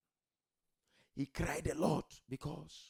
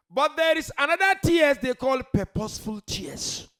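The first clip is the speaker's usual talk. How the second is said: raised, high preacher's call; emphatic preaching; 12 semitones higher, 13 dB louder than usual. Real, not acted. Preacher introducing purposeful tears to his congregation, emphatic raised voice. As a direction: proclaim it loudly to the congregation.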